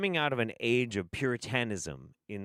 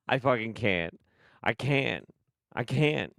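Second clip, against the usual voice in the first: ordinary talk, nothing marked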